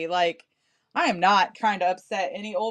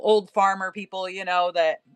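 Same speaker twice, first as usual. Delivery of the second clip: normal talking voice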